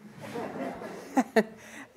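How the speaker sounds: breathy laugh at the memory. Real, not acted. Laughing at his own story.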